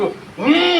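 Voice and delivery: put-on high drunken hmm; mock grunt. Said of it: "అని అనిపిస్తున్నాడు". (తాగినవాడి హుంకారం అనుకరణ)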